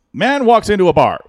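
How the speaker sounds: mock club emcee announcing a bar joke; cheesy emcee joke opener. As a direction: belt it like a corny host starting a gag. MC doing street jokes.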